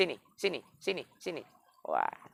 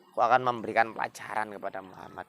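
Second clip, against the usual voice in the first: ordinary delivery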